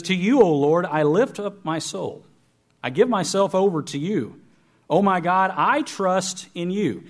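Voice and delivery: scripture reading. Acted. Preacher reading Psalm twenty-five aloud to the congregation, fervent.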